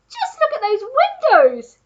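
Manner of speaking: high, loud excited exclamation; monkey crying out. A narrator's reading. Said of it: "cried the monkey".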